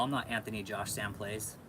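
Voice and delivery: ordinary delivery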